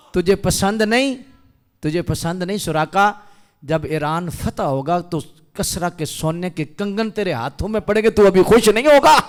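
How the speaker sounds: loud, building to a high shout; emphatic promise. Acted. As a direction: proclaim it with rising force. Acting the Prophet's promise to Suraqa. (بلند ہوتی پرجوش آواز)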